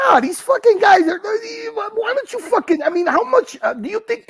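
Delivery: high furious yelling; angry shout. Real, not acted. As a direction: blow up at them. Heated rant peaks.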